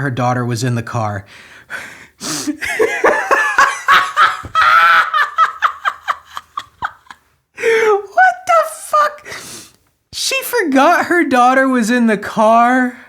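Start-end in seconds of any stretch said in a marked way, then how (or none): 2.2-6.9 loud, very high cackling laugh; helpless high laughter. Real, not acted. Reader cracks up at the news article, laughing hard. 7.6-9.3 very high, drawn-out squeal through laughter; incredulous squeal. Real, not acted. Reader reacting to an absurd excuse, squealing in disbelief. 10.1-13.0 high-pitched, raised-voice disbelief; incredulous. Real, not acted. Reader repeats the mother's excuse, high and incredulous.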